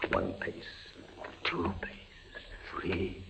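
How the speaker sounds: soft, tense whisper; hushed counting. Acted. Prisoner pacing his cell blind, hushed counting. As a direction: count each step in fear.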